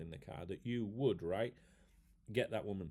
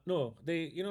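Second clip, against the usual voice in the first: ordinary talk, nothing marked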